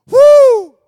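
high, loud joyful whoop; excited whoop. Acted. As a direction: let out a big celebratory woo. Preacher cheering freedom.

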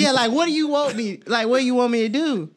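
high, loud frustrated pleading; exasperated plea. Pretend. Man pleading with his partner over no sex, exasperated. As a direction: demand an answer, voice pitched right up.

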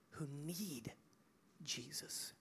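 very soft, low near-whisper; hushed reverence. Real, not acted. Preacher telling church who resurrection life is for, hushed. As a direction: drop to a reverent hush, lingering. Hushed climax of the sermon point.

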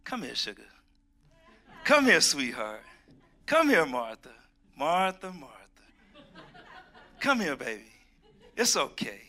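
gentle and loving. Retold or imitated. Jesus comforting the flustered Martha, tender and kind. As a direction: call her over kindly like a loved one. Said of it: "I could see him saying".

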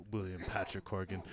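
low, soft, grand name; self-important tone. Retold or imitated. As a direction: say the full name with mock gravity. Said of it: "when he's like".

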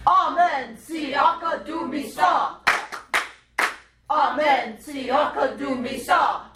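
rhythmic put-on African call. Shown as a demonstration. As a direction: lead the chant in an imitated African voice. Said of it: "say it with your African voice".

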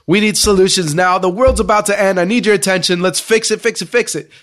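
hammed-up panic; frantic Jethro. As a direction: burst in panicking about the world ending. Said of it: "He's cussing like crazy and saying".

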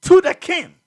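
loud, high burst; sudden shout. Acted. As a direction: fling the words out. Sudden sermon exclamation.

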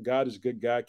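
normal talking voice

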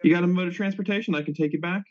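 usual speaking voice

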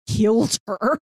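ordinary talk

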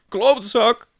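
high, loud howled insult; howling. A narrator's reading. Man with fist stuck in narrator's mouth, howling. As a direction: wail the curse out. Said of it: "huilt hij".

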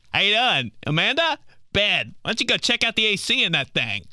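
high-pitched, pushy used-car pitch; car salesman. Acted. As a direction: sell it like a car dealer. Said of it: "like I'm about to sell her a two thousand four Honda Civic".

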